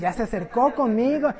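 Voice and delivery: ordinary talk, nothing marked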